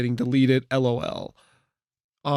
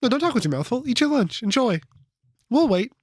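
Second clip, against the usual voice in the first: passive-aggressive; 8 semitones higher than usual. Real, not acted.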